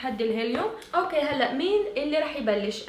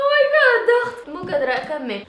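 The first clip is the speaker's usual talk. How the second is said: laughing; 4 semitones higher, 7 dB louder than usual. Real, not acted. Laughing helplessly.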